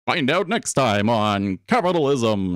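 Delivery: playful, dramatic next-episode teaser; mock show announcer. Host jokingly teasing the next episode of Capitalism, mock announcer. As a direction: tease the audience like a serial's announcer.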